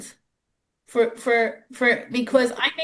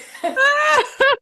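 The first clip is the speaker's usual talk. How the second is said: loud, very high laugh; high burst of real laughter; 5 dB louder than usual. Real, not acted. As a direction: crack up at your own joke. Laughing at own bad-breath joke.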